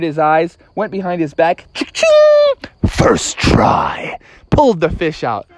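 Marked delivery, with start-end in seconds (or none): 1.7-2.7 high, loud-edged cha-ching; spear sound effect. Acted. Dad spears a fish behind his back, high sound effect. 2.9-4.2 voiceless triumphant aside; boastful rasped whisper. Acted. Dad lands the fish at once, whispered boast.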